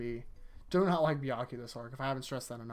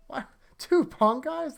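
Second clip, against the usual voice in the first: much higher, louder sarcastic question; mock disbelief; 11 semitones higher, 6 dB louder, slower than usual. Real, not acted. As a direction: sound scandalized at the idea.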